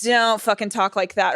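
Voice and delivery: ordinary talk, nothing marked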